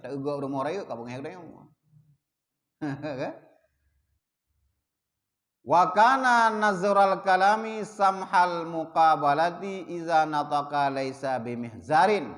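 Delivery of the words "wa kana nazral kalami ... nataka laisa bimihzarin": slow, chanted Arabic reading; Arabic text recitation. Acted. Teacher recites the Arabic source to students, slow chant. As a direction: intone the Arabic line slowly.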